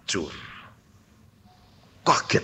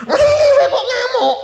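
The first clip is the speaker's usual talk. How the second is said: very high, loud, drawn-out yell; mock angry shout; 6 dB louder, slower than usual. Father flaring up at mother over spilled sambal, mock furious shout. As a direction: blow up in a mock rage.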